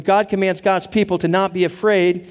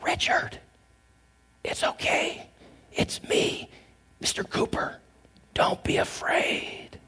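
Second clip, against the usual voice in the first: gentle whisper; 9 dB quieter than usual. Retold or imitated. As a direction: whisper it close to a scared child's ear. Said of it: "whispered in my ear in a gentle voice".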